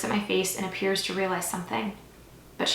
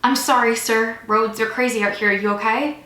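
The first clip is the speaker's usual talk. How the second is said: loud, raised call, rising question at the end; shouting over rain; 3 semitones higher, 6 dB louder than usual. A narrator's reading. Driver to officer through the storm, shouting over rain. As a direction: call out to be heard over a downpour. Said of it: "I shouted over the rain".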